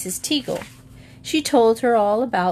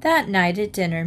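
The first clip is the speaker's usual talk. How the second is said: ordinary delivery, nothing marked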